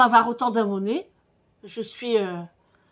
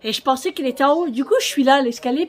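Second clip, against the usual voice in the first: ordinary talk, nothing marked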